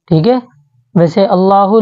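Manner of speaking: ordinary talk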